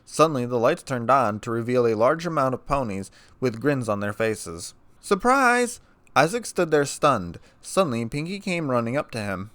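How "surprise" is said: high, loud party cheer; surprise shout. Acted. Party ponies springing a surprise on Isaac, loud high shout. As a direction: leap out and yell it.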